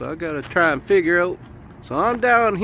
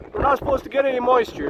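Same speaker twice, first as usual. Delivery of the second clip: ordinary talk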